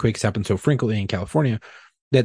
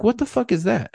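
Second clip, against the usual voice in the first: shocked wife; 12 semitones higher than usual. Retold or imitated. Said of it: "She's like".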